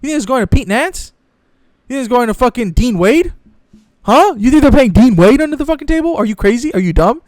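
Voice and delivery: sarcastic, disbelieving challenge; incredulous. Real, not acted. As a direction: scoff at the idea in disbelief. Incredulous mocking rant.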